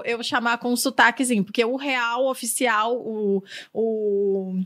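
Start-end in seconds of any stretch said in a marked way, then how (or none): none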